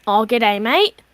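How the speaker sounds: hammed-up Aussie greeting, lifting on "mate"; Australian accent. Shown as a demonstration. Player proves Quentin's accent to game master, hammed-up Australian. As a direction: show off a stock Australian accent. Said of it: "If you can't do an Australian accent".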